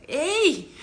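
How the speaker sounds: sudden high shout of Ey; loud call for attention. Retold or imitated. Parent shows how a child calls out, shouting. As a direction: shout to get noticed. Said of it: "Es como decir".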